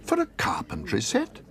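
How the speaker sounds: can't believe the price; astonished. Acted. Paddington shocked at price.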